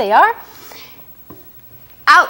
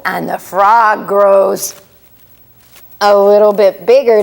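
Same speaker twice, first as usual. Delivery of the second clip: slow and louder, puffing up big; big swelling voice; 3 semitones lower, 4 dB louder than usual. Acted. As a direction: let the voice swell as the frog grows. Frog grows, voice swells.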